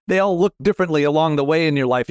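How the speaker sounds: ordinary talk, nothing marked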